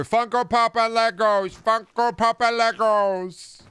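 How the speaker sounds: loud, high-pitched jeering chant; mocking parody. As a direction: squawk it like a silly sales cry. Mocks geek shops' merchandise again.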